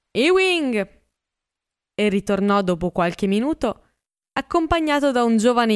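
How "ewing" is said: higher called name across the house; calling out. A narrator's reading. Gatsby calling the pianist from the hall, raised high. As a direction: call him from another room. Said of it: "Uscì dalla stanza chiamando".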